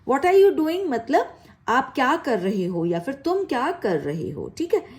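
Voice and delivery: ordinary talk, nothing marked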